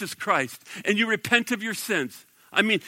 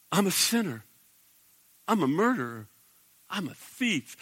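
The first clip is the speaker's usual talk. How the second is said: quiet confession; 6 dB quieter than usual. Retold or imitated. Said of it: "simple repentance".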